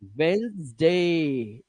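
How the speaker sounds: Wednesday stretched as "wennnz-daaay"; very slow teacher's model of Wednesday; slow pronunciation demo. Shown as a demonstration. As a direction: draw out both syllables of Wednesday. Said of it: "ये ऐसे है, देखो".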